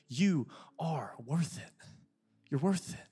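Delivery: slow and soft reassurance; God softly telling a listener their worth; tender assurance. Acted. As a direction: lean in and slow right down. God's voice, softened.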